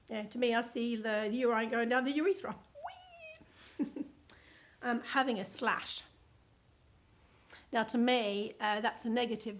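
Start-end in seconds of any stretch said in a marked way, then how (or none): 3.8-4.1 gleeful slide cry; playful whee. Pretend. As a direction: whoop it like a kid on a slide. Acting sliding down a slide.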